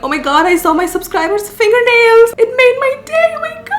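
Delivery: rising fangirl squeal; giddy squeal. Retold or imitated. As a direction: squeal to mom with glee. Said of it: "I go to my mom and I'm like".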